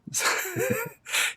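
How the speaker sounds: short high laugh; a quick high giggle in character; light amused laugh; character laughs a little. Acted. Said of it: "He laughs a little bit".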